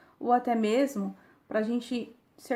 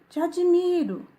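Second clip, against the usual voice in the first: high, half-raised call; narrated shout; 8 semitones higher, 5 dB louder than usual. A narrator's reading. Father calls to the mountain, mild shout. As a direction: call it out, much higher. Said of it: "o pai grita para a montanha".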